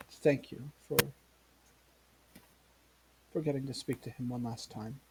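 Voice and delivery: soft, halting thanks, only mildly tearful; grieving mother. Acted. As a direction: trail off gently, holding back tears. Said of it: "of sobbing, the mother".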